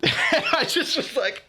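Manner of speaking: speaking through helpless laughter; laughing. Real, not acted. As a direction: laugh it out in disbelief. Laughing at how bad it went.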